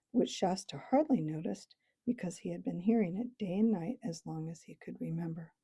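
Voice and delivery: ordinary delivery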